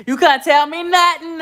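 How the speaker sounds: proud boast, voice jumping up on "nothing"; "You couldn't tell me nothing" hyped up and loud; excited brag. Real, not acted. Bragging about new car.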